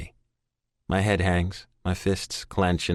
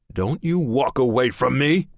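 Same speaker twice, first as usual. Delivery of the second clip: father's angry shout, higher and louder; thundering shout; 6 semitones higher, 4 dB louder than usual. A narrator's reading. Father to son walking away, thundering angry shout. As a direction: stop him with a roar. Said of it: "His voice thunders".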